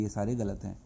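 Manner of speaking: normal talking voice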